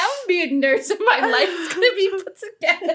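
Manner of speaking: high, bright mock-hopeful voice, laughing through it; mocking naive optimism. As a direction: send up her younger self's big plan. Mock naive nurse plan quote.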